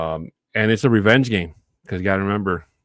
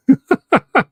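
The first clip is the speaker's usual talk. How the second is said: high laugh; 12 dB louder than usual. Real, not acted. Laughs at own joke.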